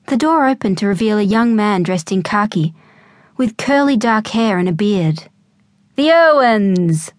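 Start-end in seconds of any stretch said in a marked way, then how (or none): 5.9-7.1 slow, louder, smiling welcome; beaming greeting. A narrator's reading. Young man welcoming the family at his door. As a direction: greet old friends with a big grin. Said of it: "beamed a large smile".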